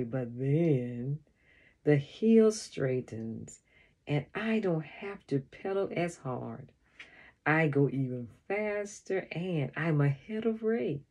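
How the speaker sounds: plain reading voice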